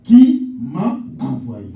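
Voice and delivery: voiced quote, drawn out; loud slow question. Pastor voices Moses questioning God, loud and slow. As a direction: ask it slowly and loudly like Moses.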